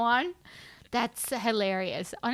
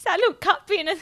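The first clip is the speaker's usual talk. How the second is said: laughing quote; 7 semitones higher, 7 dB louder than usual. Retold or imitated. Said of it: "Can you imagine".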